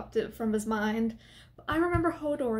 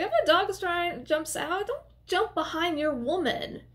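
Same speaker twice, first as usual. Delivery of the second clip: higher, fast exasperated scolding; exasperated rant; 6 semitones higher than usual. Real, not acted. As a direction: scold him in disbelief. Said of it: "ranting at character".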